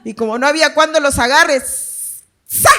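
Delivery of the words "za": sharp, sudden whoosh for a flung spoon; imitated throwing noise. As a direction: spit out a quick sound effect of a throw. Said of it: "le lanzaba la cuchara". (sonido imitado de lanzamiento)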